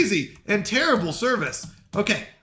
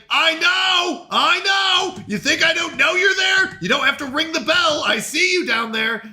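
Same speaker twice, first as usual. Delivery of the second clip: exasperated call; 7 semitones higher than usual. Acted. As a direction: snap back at the bell-ringer. Customer keeps ringing bell.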